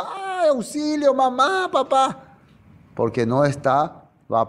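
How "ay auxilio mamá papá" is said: high, slow wail of panic; mock terror, crying out for mom and dad; panicked cry for help. The unprepared crying out when Jesus comes, in high mock panic.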